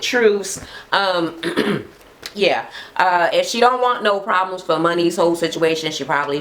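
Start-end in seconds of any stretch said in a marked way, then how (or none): none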